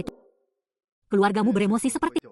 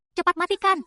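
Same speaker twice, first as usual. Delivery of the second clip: panicked shout; 7 semitones higher than usual. Acted. Panicked order to stop screen.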